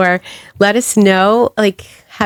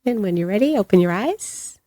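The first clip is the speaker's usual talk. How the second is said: guided meditation; 2 semitones lower, 4 dB quieter than usual. Acted.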